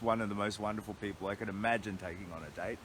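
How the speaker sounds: ordinary talk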